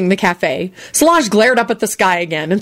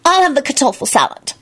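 higher, irritable; irritable order; 8 semitones higher than usual. A narrator's reading. Solange ordering from the waiter, higher and irritable. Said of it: "she informed the waiter irritably".

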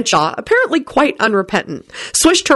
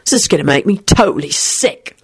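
muttered disgust. A narrator's reading. William to Rosie about the couple, muttering. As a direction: drag out the opening in disgust. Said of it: "William muttered to Rosie".

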